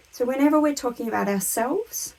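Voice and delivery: ordinary talk, nothing marked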